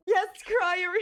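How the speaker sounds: usual speaking voice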